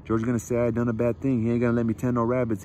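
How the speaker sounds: usual speaking voice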